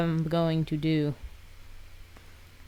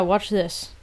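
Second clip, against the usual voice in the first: usual speaking voice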